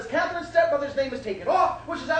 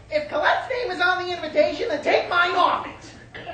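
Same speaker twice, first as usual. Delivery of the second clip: raised, higher-pitched ultimatum; higher voice, drawing out the final demand; indignant mother; 6 semitones higher, 4 dB louder than usual. Acted. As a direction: play an offended mother laying down terms. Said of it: "She says".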